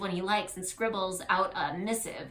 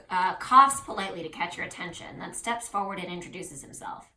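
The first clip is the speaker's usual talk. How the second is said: ordinary talk, nothing marked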